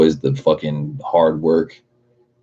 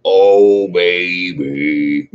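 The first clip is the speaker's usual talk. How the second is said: put-on louder, bellowing bluesman "Oh, baby"; 5 dB louder, much slower than usual. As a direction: belt it like an old blues shouter. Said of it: "be like [name]".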